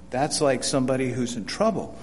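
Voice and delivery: ordinary talk, nothing marked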